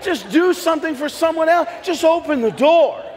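excited shout. Retold or imitated. His excited reply retold.

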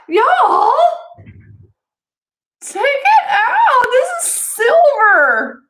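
high, slow, loud excited call to viewers; thrilled squeal at the silver; excited shout. Real, not acted. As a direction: shout the find out with delight. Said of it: "I'm excited".

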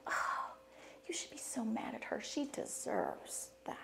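unvoiced, soft Satan whisper; a devil whispering, egging her on; tempting whisper. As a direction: hiss the temptation in her ear. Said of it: "And he says".

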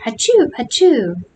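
two fake sneezes, the first pitched high; hammed-up double achoo; mock sneezing fit. A narrator's reading. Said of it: "if he'll ever stop sneezing".

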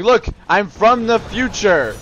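high, loud plea to be believed; play-acted loud explaining; mock insistent declaration. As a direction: announce it urgently, hammed up.

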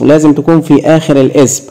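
normal talking voice

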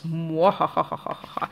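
slow theatrical villain cackle; evil laugh. Pretend. Mock villain gloating at viewers.

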